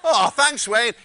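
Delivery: laughing. Retold or imitated. Amused guest replies.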